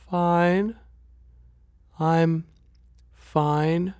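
put-on high, faraway girl's voice with long pauses; dazed, high-pitched, halting reply; drugged sister. A narrator's reading. Drugged sister answers in a stupor.

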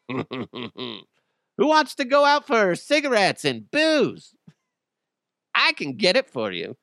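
high, slow, teasing put-on woman's voice; mock flirty woman.

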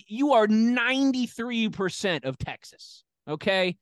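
slow, loud emphatic jab. Real, not acted. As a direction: lean into each word.

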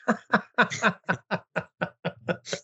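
long run of chuckles, slightly high; laughter. Real, not acted. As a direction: laugh steadily at your friend. Laughs at the celebration.

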